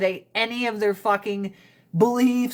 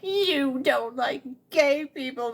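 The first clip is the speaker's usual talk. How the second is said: slow, higher mock accusation; accusing teen; 4 semitones higher than usual. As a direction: drag it out like a sulky teen. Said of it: "I was all like".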